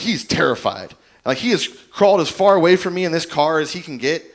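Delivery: normal talking voice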